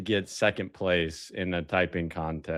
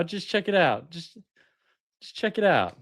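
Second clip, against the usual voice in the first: high teasing voice; 8 semitones higher than usual. Acted.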